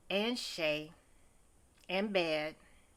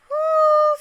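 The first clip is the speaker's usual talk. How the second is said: high, loud whoo of delight; excited whoop; 16 dB louder than usual. Real, not acted. Admiring a character's fur outfit, loud whoop. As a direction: let out a big impressed whoop.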